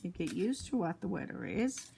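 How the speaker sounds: ordinary delivery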